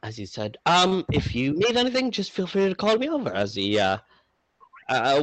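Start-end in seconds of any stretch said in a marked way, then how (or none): 0.6-3.3 higher-pitched, lightly voiced barman; half-elf barman. Half-elf serving his customers, lightly voiced higher.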